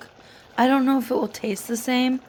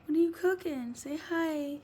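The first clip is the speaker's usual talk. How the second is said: pet's voice; 6 semitones higher, 7 dB quieter than usual. Pretend. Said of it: "He's like".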